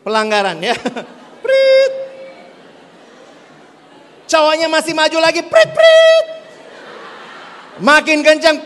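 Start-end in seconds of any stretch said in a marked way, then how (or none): 1.4-2.1 high mock whistle through a laugh; playful mouth whistle, laughing; laughing whistle blow. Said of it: "wanita tiup peluitnya". 5.5-6.3 two very high, louder mock whistle blasts; double whistle blow. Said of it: "wanita tiup peluitnya".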